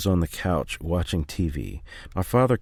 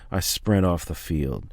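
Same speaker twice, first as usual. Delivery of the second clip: plain reading voice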